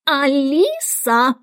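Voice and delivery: drawn-out high call of the name; shrill call. A narrator's reading. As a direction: summon her across the court. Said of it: "закричал пронзительным голосом".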